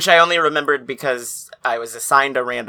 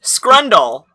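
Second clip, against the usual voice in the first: mock curse; 7 semitones higher, 10 dB louder than usual. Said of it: "Try some curse words".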